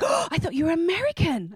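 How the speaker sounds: imitated surprise. People in Ireland surprised.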